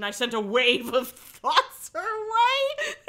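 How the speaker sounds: reading through rising laughter; high, loud, laughter-choked words; cracking up. Real, not acted.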